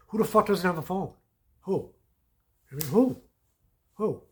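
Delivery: incredulous retold shout; angry retold yell. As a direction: hammer the question in mock outrage. Retelling his angry reply to scammer.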